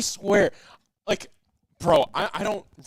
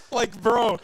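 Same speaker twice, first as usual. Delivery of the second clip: normal talking voice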